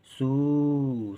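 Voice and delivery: सू with a long held oo; drawn-out vowel. Shown as a demonstration. Teacher explaining the big matra, drawn-out vowel. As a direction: hold the vowel in सू. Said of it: "लेकिन देर तक".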